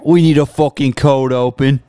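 Birmingham gangster voice drifting Australian; Arthur Shelby impression. Co-host does his Arthur impression for a cold open, gangster accent. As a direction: growl it like a Peaky Blinders heavy. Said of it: "I want you to do the impression".

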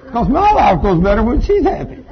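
high, loud, laughing through the line; laughing punchline to the congregation. Real, not acted. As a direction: crack up as you land the joke. Preacher jokes about pleasing wife.

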